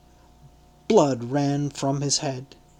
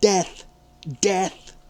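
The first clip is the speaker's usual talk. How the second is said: mob shouting; 5 semitones higher than usual. Acted.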